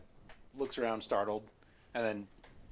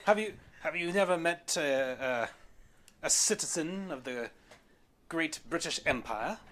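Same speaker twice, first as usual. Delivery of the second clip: raised, affected British gentleman voice; eccentric British gentleman; 7 semitones higher, 8 dB louder than usual. Acted. Gentleman asks whether they have met a British citizen, grandly eccentric. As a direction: sound astonished and grand, with British accent.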